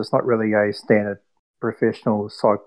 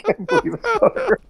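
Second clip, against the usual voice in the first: very high, laughing through it; laughing squeal. Retold or imitated. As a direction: squeak it out while cracking up. Catchphrase repeated, cracking up.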